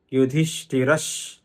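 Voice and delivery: "యుధిష్ఠిరష్" slowly, ending on a bare sh; విసర్గం పొల్లు షా. Shown as a demonstration. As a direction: close "యుధిష్ఠిరష్" with a long sh and no vowel. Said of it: "పొల్లు షా అవుతుంది". ("యుధిష్ఠిరష్" చివర పొల్లు ష్ సాగదీసి)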